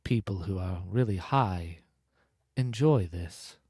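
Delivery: low, measured put-on narrator voice; audiobook voice. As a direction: slip into a low audiobook delivery. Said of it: "'cause he did the voice".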